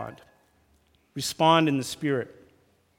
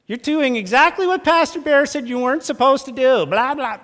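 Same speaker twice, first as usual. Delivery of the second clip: higher, louder whiny quoting of the pastor; mock nagging spouse; 9 semitones higher, 5 dB louder than usual. Spouse quoting the pastor in a fight, higher whiny mock. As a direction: throw the pastor's words back at your spouse. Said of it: "don't quote your pastor".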